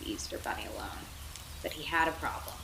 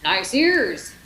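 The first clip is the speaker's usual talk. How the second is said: joking; 12 dB louder than usual. Acted. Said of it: "Ham joked".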